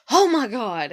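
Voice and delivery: loud, high exasperated oh my God; husband relieved after parking; relieved exclamation. Retold or imitated. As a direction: blurt it in relief. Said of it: "he's like".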